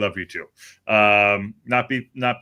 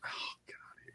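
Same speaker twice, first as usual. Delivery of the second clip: breathy whispered despair; barely voiced, exasperated; whispered groan; 21 dB quieter than usual. Real, not acted. Exasperated aside about Annie.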